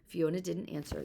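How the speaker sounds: usual speaking voice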